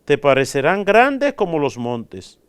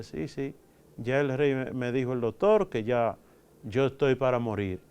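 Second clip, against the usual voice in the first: softer, faint voice of a sick king; weak dying king; 4 semitones lower, 8 dB quieter than usual. Acted. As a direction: let the king's words barely come out. Dying king answers jester. (el rey moribundo responde al bufón con voz débil y baja)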